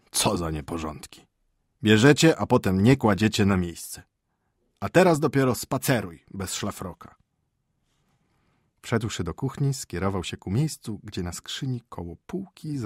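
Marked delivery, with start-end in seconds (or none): 0.1-7.1 loud, irritated scolding of servants; peeved husband telling off the nanny; grumbling master. Acted. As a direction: complain crossly about the disorder.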